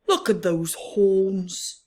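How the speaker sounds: slow wistful rhino voice. Acted. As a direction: sigh over the others' horns. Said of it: "Rhino said wistfully to himself".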